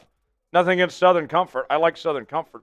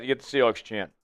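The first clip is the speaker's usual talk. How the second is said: usual speaking voice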